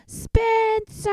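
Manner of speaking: high, loud yelled name. A narrator's reading. Mom yells for her son from the stairs. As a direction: yell his name up the stairs. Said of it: "she yelled on her way upstairs".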